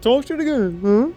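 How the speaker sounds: high, sneering provocation; taunting dare. Retold or imitated. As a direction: goad him into swinging. Defender taunts gang member.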